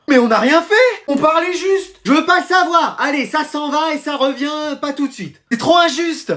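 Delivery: high, aggrieved plea; indignant protest. Acted. Sent to the headmaster, indignant protest.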